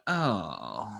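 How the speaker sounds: drawn-out sinking groan; mock disappointment. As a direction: let the word sag in exaggerated letdown. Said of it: "the disappointment's galactic".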